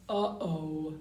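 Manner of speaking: plain reading voice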